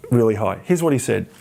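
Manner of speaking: usual speaking voice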